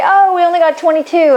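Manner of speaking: high-pitched imitation of let-down clients; mock disappointment. Couples reporting their score to the coach, mock disappointment.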